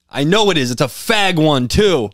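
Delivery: mock the farmer's scorn; angry farmer. A mad farmer scolding kids over a pink BB gun, lightly imitated. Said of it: "He's like".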